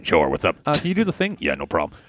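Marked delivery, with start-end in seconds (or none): 0.0-0.6 low put-on sidekick voice; Chuck. Chuck called in by the host, low character voice. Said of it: "Uh, Chuck, you come in here?". 1.4-2.0 low put-on sidekick voice; Chuck. Chuck called in by the host, low character voice. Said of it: "All right, uh, Chuck".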